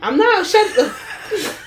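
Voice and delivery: high squeal through laughter; cracking up while refusing; laughing protest; laughing refusal. Real, not acted. Laughing refusal at slave play.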